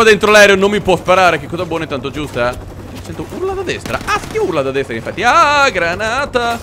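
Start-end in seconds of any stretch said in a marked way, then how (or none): 5.2-6.6 high, drawn-out yelp; alarmed cry. Real, not acted. Player spotting a grenade, alarmed cry.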